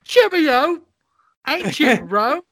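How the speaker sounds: high Cockney street voice; cheery Cockney greeting; Cockney accent. Pretend. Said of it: "I didn't have a Cockney accent".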